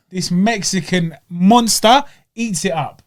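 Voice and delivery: ordinary talk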